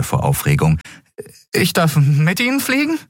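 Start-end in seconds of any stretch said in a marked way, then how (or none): 1.5-3.0 high, trembling with excitement; hardly believe it; excited boy. Acted. (aufgeregte Jungenstimme)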